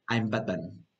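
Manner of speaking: low, soft, serious film hero; Batman. Acted. Teacher imitating Batman's film answer, low, quiet, serious. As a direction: say it gravely, dropped low and quiet. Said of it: "en varias de las películas es como responde".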